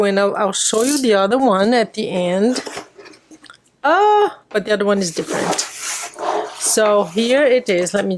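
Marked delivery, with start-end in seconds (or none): 3.8-4.4 sudden high oh of realising; bright little oh of surprise; surprised oh. Real, not acted. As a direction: catch yourself mid-thought. Remembers the second kit differs.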